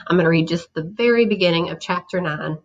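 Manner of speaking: ordinary delivery, nothing marked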